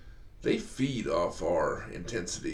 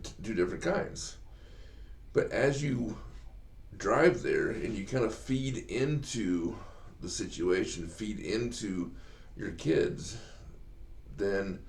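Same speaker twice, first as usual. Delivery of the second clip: ordinary talk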